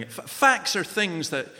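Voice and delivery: normal talking voice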